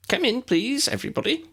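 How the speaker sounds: high, squeaky little professor; small old professor calling the class in, squeaky and high. A narrator's reading. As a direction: pipe it up high like a tiny wizard teacher. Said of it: "came a squeaky voice from inside".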